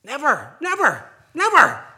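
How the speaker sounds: loud rising triple "never", each falling; politician's defiant never. Retold or imitated. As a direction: hammer out "never" three times like a politician. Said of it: "like one of our past politicians".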